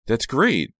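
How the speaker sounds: plain reading voice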